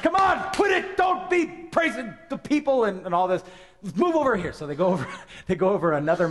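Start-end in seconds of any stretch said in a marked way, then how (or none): 0.0-4.5 much higher, loud shouting rebuke; angry king. Acted. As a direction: bellow orders at the prophet. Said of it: "And so Balak does that".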